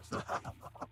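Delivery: soft, short evil laugh; hushed villainous snicker; menacing chuckle. Acted. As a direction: quietly gloat that you are worse. Villain claims to be worse.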